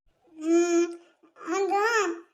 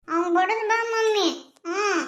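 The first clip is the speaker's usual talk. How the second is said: put-on crying child; 3 semitones higher, 3 dB louder, slower than usual. Child begs mother to go hit the boy, sobbing. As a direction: wail it through tears.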